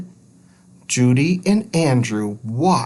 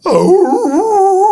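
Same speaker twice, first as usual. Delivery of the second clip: much higher, loud whine; dog whine; 7 dB louder than usual. Acted. Harley being grabbed by the collar, whining. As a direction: whimper like a dog. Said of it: "Harley whined".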